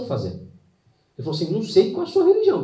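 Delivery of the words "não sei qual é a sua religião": sudden high, loud exclamation; astonished doctor. Retold or imitated. Doctor amazed at the recovery, exclaiming high and loud. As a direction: blurt it out in disbelief.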